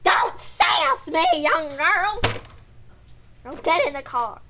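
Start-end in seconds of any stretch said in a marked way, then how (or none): 0.0-2.2 loud, drawn-out telling-off; sharp raised-voice rebuke; scolding mom. Acted. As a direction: scold a cheeky daughter loudly. Mother scolds daughter for backtalk.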